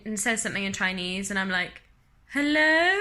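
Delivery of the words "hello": loud, high questioning call; baffled phone greeting. Retold or imitated. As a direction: call uncertainly down the line, rising at the end.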